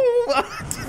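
high-pitched laugh. Real, not acted. Laughing at own voice crack.

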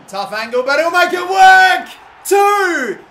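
very high, loud rising goal yell; goal celebration shout. Real, not acted. Gamer celebrating the second goal, very loud and high. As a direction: build up and explode on the goal.

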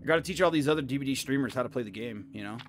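talking while eating a pretzel; mouth full. Real, not acted. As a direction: chew and talk at once. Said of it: "you guys hear me crunching".

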